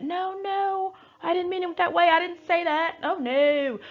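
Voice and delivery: mock denial in a very high voice; high-pitched, put-on innocent protest; Sutton.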